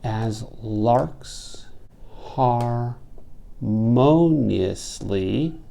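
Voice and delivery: "As larks harmoniously" drawn out very slowly, beat by beat; metrical scansion. Shown as a demonstration. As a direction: stretch "harmoniously" syllable by syllable with a big "MO". Scanning the metre aloud.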